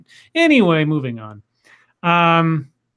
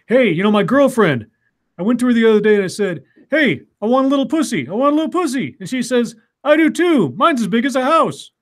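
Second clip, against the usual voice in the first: louder, higher joke-teller voice; joke-telling voice; 7 semitones higher, 5 dB louder than usual. Retold or imitated. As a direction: tell the punchline with a big high grin. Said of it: "The guy's telling the joke".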